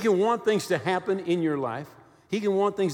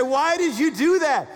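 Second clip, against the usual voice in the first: raised, higher-pitched mock questioning; acted challenge; 9 semitones higher, 6 dB louder than usual. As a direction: put the question loudly, as an imagined questioner. Acts out listener's challenging question.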